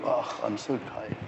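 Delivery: a hint of a limp excuse; mock weary sigh. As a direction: play the worn-out churchgoer. Mimicking tired churchgoer.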